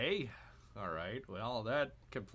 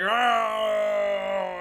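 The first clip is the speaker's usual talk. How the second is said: long, loud high roar; drawn-out eighties action movie battle cry; action-hero yell; 10 semitones higher, 14 dB louder than usual. Acted. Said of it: "He's like".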